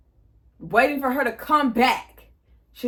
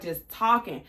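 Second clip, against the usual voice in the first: ordinary talk, nothing marked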